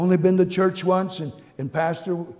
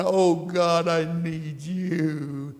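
heart crying; much slower than usual. Retold or imitated. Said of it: "my heart's crying".

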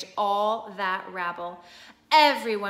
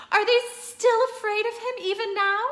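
higher, louder tearful question; tearful Susan; 10 semitones higher, 5 dB louder, faster than usual. A narrator's reading. Susan sobbing in disbelief at the crowd, higher. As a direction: cry out in disbelief. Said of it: "sobbed Susan".

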